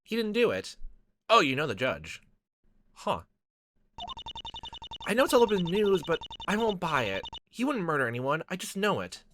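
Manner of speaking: lightly voiced determined young woman; earnest Ria voice. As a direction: defend the judge with conviction. Voicing Ria Witz character.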